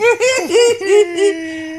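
high, loud rapid giggling; giggle. Acted. Reader greeting the friend who arrives, giggling gleefully. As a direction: giggle in a long high run. Delighted as Tilly turns up.

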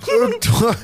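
high, very slow put-on voice; a frail captive asking, stretched out; old prisoner. Freed prisoner asking his rescuer who sent him, high and slow.

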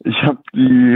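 ordinary talk, nothing marked

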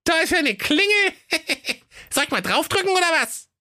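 put-on high squeaky little boy voice; a bratty kid bossing his siblings; cheeky little boy. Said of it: "der Däumling rief".

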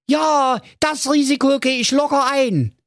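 put-on high, carefree young man calling out; Marco calling out. Marco calls out to the dwarf, carefree and high. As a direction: toss it off cockily. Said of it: "rief da der Marco Magerhals".

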